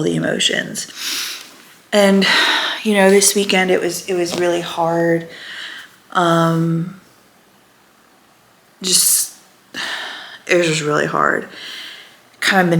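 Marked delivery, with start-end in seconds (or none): none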